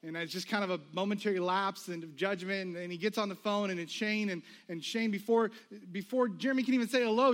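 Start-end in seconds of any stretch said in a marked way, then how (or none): none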